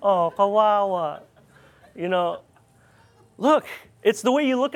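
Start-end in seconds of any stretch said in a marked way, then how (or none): none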